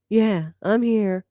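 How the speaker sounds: slow, drowsy yeah I'm here; sleepy mock answer. Vlogger mocking a groggy streamer, slow and sleepy. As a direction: drag it out like just woken. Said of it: "This girl went from literally".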